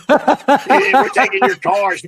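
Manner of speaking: high, loud mock parent, cracking up with laughter; laughing mock parents. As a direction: play the strict parents, laughing through it. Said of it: "her parents were like".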